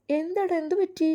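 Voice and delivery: high-pitched anxious question; acted worried cry; alarmed mother. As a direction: ask in rising panic, as a worried mother. Mother alarmed by son's hospital news.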